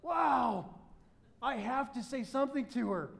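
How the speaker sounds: put-on retelling a stunned young man; smitten panic. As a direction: gasp it out like seeing her for the first time. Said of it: "And I looked down and was like".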